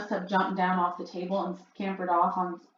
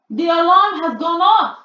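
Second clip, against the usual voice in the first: much higher, loud thrilled shriek; 10 semitones higher, 13 dB louder than usual. A narrator's reading. Grand High Witch shrieking to the audience in triumph. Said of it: "shrieked the Grand High Witch".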